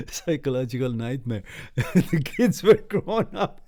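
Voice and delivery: laughing through the words, high. Real, not acted. Laughing at a school memory, high and loud.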